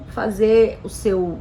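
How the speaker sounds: usual speaking voice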